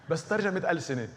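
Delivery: laughing. Real, not acted. Joke after laughing.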